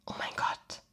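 tonlos und viel leiser geflüstert; Flüstern. A narrator's reading. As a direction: hauche es entsetzt, ohne Stimme. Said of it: "flüsterte irgendwer". (ein Zuschauer flüstert entsetzt beim Anblick des Mannes)

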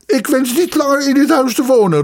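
loud, very high-pitched outburst; tearful, furious cry. A narrator's reading. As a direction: cry out in rage, near breaking. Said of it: "riep de student huilend met een woedende blik". (huilend en woedend roepen)

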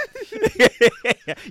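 very high loud laugh; high squealing laugh; shrieking laugh. Real, not acted. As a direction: crack up. Laughs at Pablo joke.

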